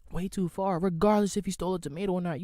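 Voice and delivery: loud, high, worked-up outrage; real indignant shouting rant; outraged ranting. Real, not acted. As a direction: yell it like you can't believe it. Outraged rant about cop beating.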